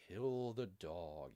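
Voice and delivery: slow, low, drawn-out repeat; savoured echo. Pretend. Bear savouring the new book title, savoured echo.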